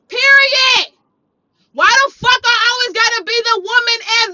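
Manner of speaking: loud, high, emphatic scolding; furious tirade. Retold or imitated. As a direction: lay into him at full voice.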